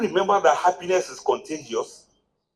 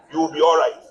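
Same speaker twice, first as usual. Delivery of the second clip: ordinary talk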